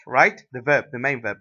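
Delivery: usual speaking voice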